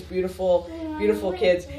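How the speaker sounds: ordinary talk, nothing marked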